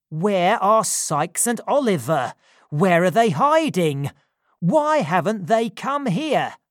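loud, urgent questions; frantic and demanding; panicked. A narrator's reading.

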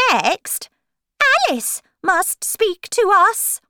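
high, loud court-crier voice. Acted. Rabbit calling Alice to speak, high announcing voice.

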